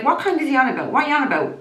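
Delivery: retold angry comeback; indignant retort. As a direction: snap back in outrage. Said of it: "I'm like".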